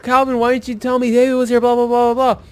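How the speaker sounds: high-pitched mimicry of a worked-up friend; retold excited complaint. Friend asks Calvin why he wasn't told, excited. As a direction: rattle it off high, into blah-blahs. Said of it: "He's, like, fucking ecstatic".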